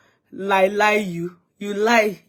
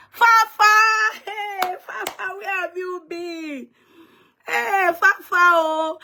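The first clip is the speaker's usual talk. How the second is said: excited greeting; 10 semitones higher, much slower than usual. Real, not acted. Greeting a viewer joining live.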